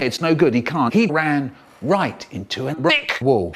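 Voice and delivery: ordinary delivery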